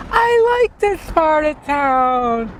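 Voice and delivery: put-on high, slow dreamy girlfriend; sweet date voice. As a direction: coo it like a smitten date.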